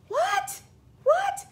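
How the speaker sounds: high, squealed disbelief; shocked squeal. Retold or imitated. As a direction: shriek it in happy shock. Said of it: "I'm like".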